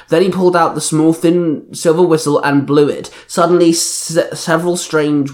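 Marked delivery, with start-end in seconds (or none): none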